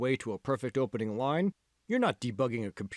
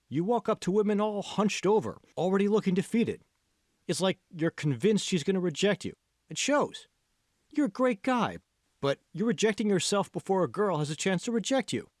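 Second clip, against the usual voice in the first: a lightly voiced friend, higher, pressing the point; earnest friend John; 6 semitones higher than usual. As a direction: push him higher and keener, like a coaching buddy. John's voice, urging Kevin.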